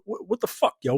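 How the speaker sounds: ordinary delivery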